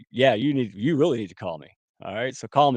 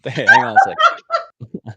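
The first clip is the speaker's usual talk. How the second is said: laughing; 5 dB louder, slower than usual. Real, not acted. Laughing at the word soliloquy.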